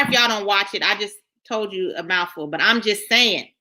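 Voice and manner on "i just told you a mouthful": ordinary talk, nothing marked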